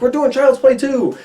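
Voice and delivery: high-pitched hyped-up sequel announcement; mock announcement. Studio announcing a sequel to fans, mock excitement. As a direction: imitate an overexcited studio reveal.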